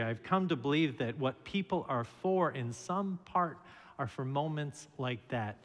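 normal talking voice

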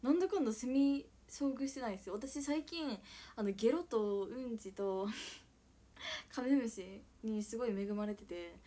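ordinary talk

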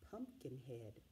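usual speaking voice

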